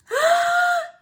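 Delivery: loud high gasping inhale; shout-gasp before a sneeze; big sneeze gasp. Acted. Gerald gasping hard as a sneeze builds.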